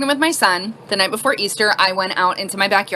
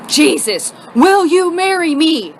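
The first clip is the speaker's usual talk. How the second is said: louder, drawn-out, exasperated mock proposal; sarcastic proposal; 7 semitones higher, 5 dB louder, much slower than usual. Boyfriend proposing mid-argument, loud and sarcastic. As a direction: propose as if utterly fed up. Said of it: "the most sarcastic person on the planet".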